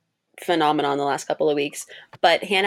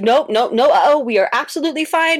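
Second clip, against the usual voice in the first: much higher and louder, brushing off the bad news; insistent denial; 7 semitones higher, 5 dB louder than usual. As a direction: overrule them cheerfully, high and loud. Refusing the nurse's uh-oh.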